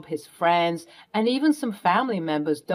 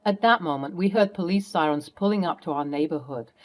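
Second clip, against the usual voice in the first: ordinary talk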